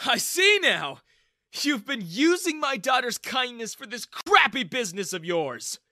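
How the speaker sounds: high, very loud accusing shout; glaring accusation. A narrator's reading. Father shouts at the young man in accusation, angry. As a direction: accuse him with venom. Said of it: "Daniel glared at me".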